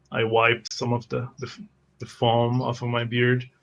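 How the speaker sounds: ordinary delivery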